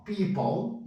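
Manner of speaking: people said slowly as PEE-pol, schwa before the L; slow model pronunciation. Shown as a demonstration. Teacher slowly models people for the class. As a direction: stretch people so the schwa is heard. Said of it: "não fala o F não, e entra um schwa aqui".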